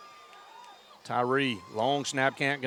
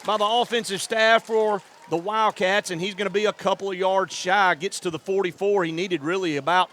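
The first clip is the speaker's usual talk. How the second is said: rising, louder, higher call as the run breaks free; excited play call; 5 semitones higher, 4 dB louder than usual. Real, not acted. Radio caller to fans as the play breaks open, excited call. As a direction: build the call with the runner, then ease off. Big screen-pass gain, live call.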